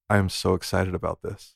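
imitating her, low, quiet deadpan; mock her lifeless enthusiasm, dropped low and soft; mock low-energy excitement. Host imitating co-host's unexcited answer, low-energy deadpan. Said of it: "the energy of that was so just minor".

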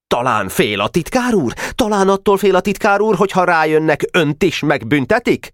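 put-on high-pitched, accusing boy's interrogation; stern questioning. A narrator's reading. Weiss challenging the secretary's courage, stern high questioning. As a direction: press the question as a stern superior. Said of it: "Weiss szigorúan kérdezte".

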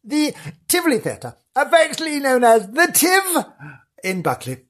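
a hint of a nervous ghost-hunter; spooky roving reporter. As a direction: tiptoe through the haunted theatre, half spooked.

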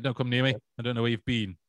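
ordinary delivery

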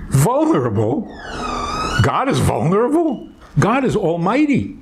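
high, disbelieving retort at the idea; incredulous. Retold or imitated. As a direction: scoff as if the idea is absurd. Doubting psychiatrist retorts on phone.

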